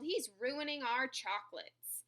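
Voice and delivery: usual speaking voice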